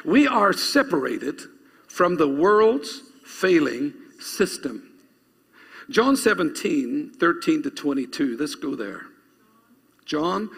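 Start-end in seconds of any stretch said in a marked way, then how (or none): 0.0-8.4 slow, deliberate phrases with pauses between; measured, emphatic dictation pace. Real, not acted. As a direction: spell it out slowly for note-takers. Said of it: "I want you to write this down".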